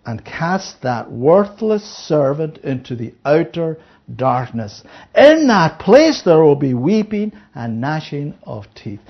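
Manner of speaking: slow, low, solemn reading of the verse; scripture reading. Acted. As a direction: read it out like holy writ.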